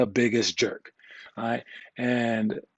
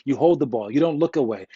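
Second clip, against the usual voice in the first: ordinary delivery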